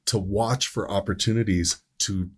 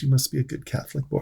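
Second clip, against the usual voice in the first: put-on sweet old lady; 5 semitones higher, faster than usual.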